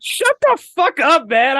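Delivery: high, slow "shut the fuck up"; exasperated mock outburst. Mocking the movie's dialogue to a friend, high slow exasperation. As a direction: drag it out, fed up with the movie. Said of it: "And I'm like".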